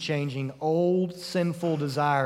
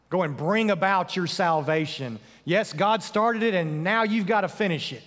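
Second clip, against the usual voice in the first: louder, higher, insistent order; demanding command; 5 semitones higher, 4 dB louder than usual. Pretend. Preacher voices a paralyzing command to fix yourself, insistent and raised. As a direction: press it as an overwhelming demand.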